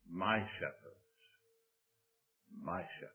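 "my shepherd" powtórzone cicho, z naciskiem na "my", bardzo miękko; tender hushed stress on my. Shown as a demonstration. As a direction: powiedz "my shepherd" jak osobiste wyznanie, cicho i wolno. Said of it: "you have to be able to say".

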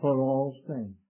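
for all saints, quiet, with all leaned on; soft weight on all; stress on all. Shown as a demonstration. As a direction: press the word all gently. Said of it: "note the emphasis of the word all".

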